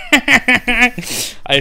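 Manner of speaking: slow words through real laughter; laughing. Real, not acted. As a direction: still laughing while speaking. Laughing at his own intro.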